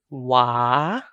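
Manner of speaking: "wa" with the A drawn out long; a prolonged, open "wa"; long vowel. Shown as a demonstration. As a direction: hold the vowel in "wa". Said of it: "long vowel a".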